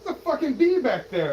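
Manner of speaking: high, loud alarm; panicked exclamation. Acted. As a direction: shout a frightened warning. Allergic man finds a bee.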